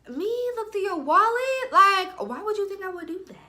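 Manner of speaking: high-pitched mock offence; feigned innocence. Woman who did look through his wallet, feigning innocence. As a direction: overdo the innocent indignation, voice way up.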